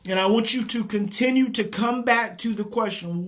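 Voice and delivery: usual speaking voice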